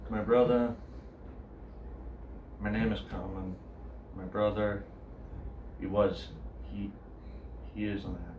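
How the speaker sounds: soft, low confessional to a meeting; grieving brother's monologue. Acted. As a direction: speak quietly and haltingly, as an addict's brother sharing. Performs monologue as the brother Colin.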